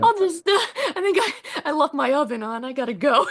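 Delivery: high, giggling mock excuse to flee; laughing excuse. Actor joking about fleeing the audition, laughing high. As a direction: make up an excuse while cracking up. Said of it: "Where's the door?".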